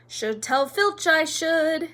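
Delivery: high, slow, falsely pious poltergeist; saintly voice. A narrator's reading. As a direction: draw it out with mock innocence. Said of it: "said Peeves in a saintly voice".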